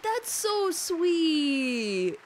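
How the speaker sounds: very slow, drawn-out cooing; tender. Real, not acted. Streamer cooing over the character, very slow and tender. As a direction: melt over the words slowly.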